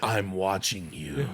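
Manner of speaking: creepy slow threat, half-joking; mock menace. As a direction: prank caller teasing the woman, low and slow. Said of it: "He's like".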